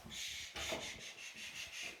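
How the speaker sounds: soft whispered shushing; gentle breathy shh to calm a pet. Real, not acted. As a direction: hush the cat steadily. Shushing a panicking cat.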